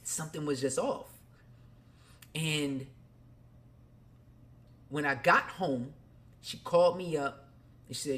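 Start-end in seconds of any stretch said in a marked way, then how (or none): none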